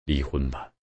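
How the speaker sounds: flat, cold and quiet; cold, quiet husband ending the marriage; coldly. A narrator's reading. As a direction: say it with no feeling left. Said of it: "卞江冷冷地说".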